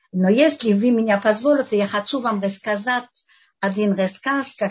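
ordinary talk, nothing marked

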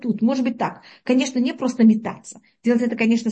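usual speaking voice

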